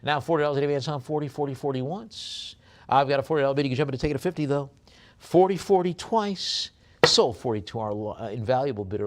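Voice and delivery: fast, high, loud auctioneer chant; rapid-fire auction call; auction bid calling. Acted. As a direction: rattle off the bids like a live auctioneer.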